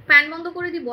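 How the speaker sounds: normal talking voice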